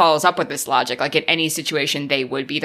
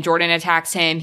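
ordinary talk, nothing marked

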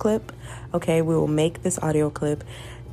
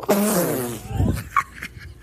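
high laugh trailing off; laughing fit; 4 semitones higher than usual. Real, not acted. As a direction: crack up at your own line. Laughs at own French boy line.

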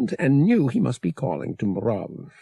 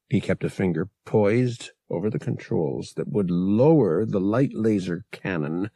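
usual speaking voice

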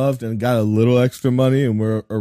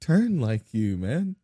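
normal talking voice

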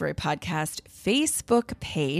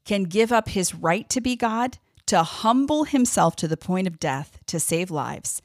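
normal talking voice

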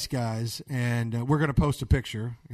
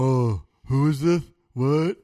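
a hint of a slow, louder clueless clerk; befuddled water department; 5 dB louder, slower than usual. Caller mocking the water department's response, lightly befuddled. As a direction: lightly mimic a confused official answering a call. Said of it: "Classic water department".

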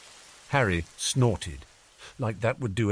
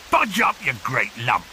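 brusque rustic giant; Hagrid; 2 semitones higher, 4 dB louder than usual. Acted. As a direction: order someone to shift, gruffly jovial. Hagrid orders Dudley off sofa.

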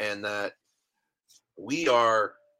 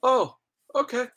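high falsetto oh, okay with a rising lilt; mock surprised relief; 13 semitones higher, 3 dB louder than usual. Arriving after the blizzard drive, high squeaky surprise.

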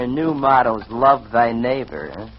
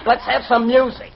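insistent demand; 9 semitones higher than usual. Acted. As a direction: press the request impatiently. Repeats the request more insistently.

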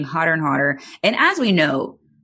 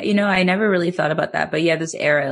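ordinary talk, nothing marked

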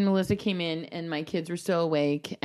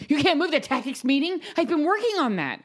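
mock rage; 9 semitones higher than usual.

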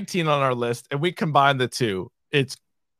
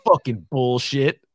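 ordinary delivery

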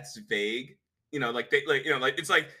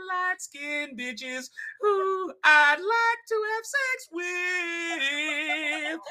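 high, loud and slow, half sung; hammed-up pundit voice that turns into a cartoon pilot; botched Ben Shapiro, much higher and slow; bad Ben Shapiro impression; 14 semitones higher, 5 dB louder, much slower than usual. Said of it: "Ben Shapiro impression".

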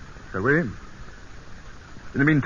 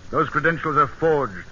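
raised, hard and loud, calling his bluff; angry accusation; 5 semitones higher, 3 dB louder than usual. Acted. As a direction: throw the accusation at him, loud and high. Accusing a former friend.

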